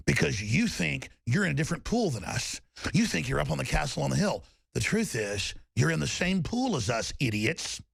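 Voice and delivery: normal talking voice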